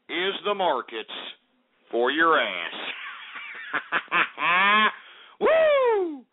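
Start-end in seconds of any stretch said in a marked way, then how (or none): 2.8-4.9 staccato laugh. Real, not acted. Celebrating end of markets. 5.4-6.2 long, loud, high "woo"; high party whoop. Real, not acted. Celebrating end of markets.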